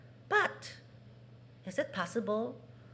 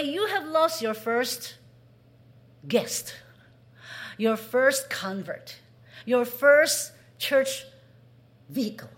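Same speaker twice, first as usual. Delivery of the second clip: preaching emphasis; 4 semitones higher, 8 dB louder, slower than usual. Acted.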